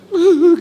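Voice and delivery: high, loud mock blubbering; imitated sobbing noise. Preacher glancing at the tearful pastor, in an imitated blubber. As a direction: burst out in a pretend wail. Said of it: "Terus saya lihat dia".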